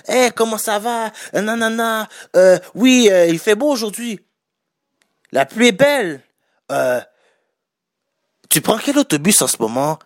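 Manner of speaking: breezy, over-friendly chatter to a stranger; chatty extravert. Retold or imitated.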